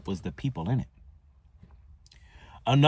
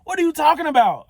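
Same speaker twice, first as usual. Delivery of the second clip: high, loud and fast exasperation; frustrated friend; 9 dB louder, faster than usual. Retold or imitated. Fraternity brother fed up with the questions, high loud exasperation. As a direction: throw the question back, fed up. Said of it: "he getting frustrated with me".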